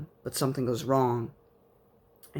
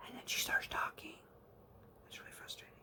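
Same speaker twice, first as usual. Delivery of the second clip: much softer, unvoiced frustrated aside; whispered aside; 17 dB quieter, faster than usual. Real, not acted. Vlogger grumbling to viewers about her pet, whispered. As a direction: let it slip out under your breath.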